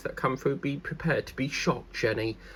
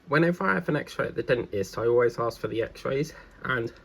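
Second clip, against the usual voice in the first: normal talking voice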